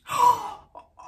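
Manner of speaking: loud, high gasp of shock. Real, not acted. Wife seeing the test result, shocked gasp. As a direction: gasp sharply in disbelief.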